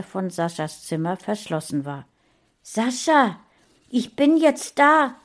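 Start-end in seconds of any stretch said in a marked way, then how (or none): 2.6-5.1 hoch und laut gerufen; rufend. A narrator's reading. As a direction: ruf zu ihm hinauf. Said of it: "rief Mona". (laut rufend)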